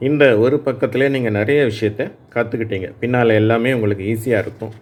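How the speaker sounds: normal talking voice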